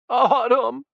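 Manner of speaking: very high, loud desperate cry; choked cry. A narrator's reading. Jessica crying out for her daughter, high and loud. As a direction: call out to the lost child. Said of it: "she choked".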